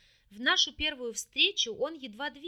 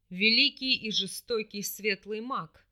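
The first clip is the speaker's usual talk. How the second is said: slow, louder, scornful demon voice; 2 semitones lower, 6 dB louder than usual. A narrator's reading. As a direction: sneer it out, slow and heavy. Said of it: "недовольно скривились в жестокой ухмылке".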